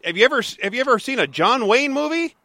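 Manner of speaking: high, disbelieving pitch; can't believe you've never seen one; incredulous. Real, not acted. Host shocked his co-host never saw a Western, disbelieving.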